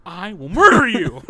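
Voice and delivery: loud, high, drawn-out playful yell; hammed-up battle threat, stretched and shouted; mock threatening shout. Challenging a friend at Bakugan, mock-menacing shout.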